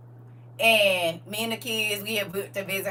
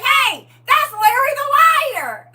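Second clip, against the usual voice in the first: high, loud, thrilled exclamation; excited recognition; 11 dB louder than usual. Retold or imitated. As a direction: spot him on the news and shout it out. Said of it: "hit my ex-husband like".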